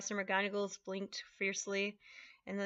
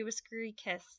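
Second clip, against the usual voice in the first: ordinary delivery, nothing marked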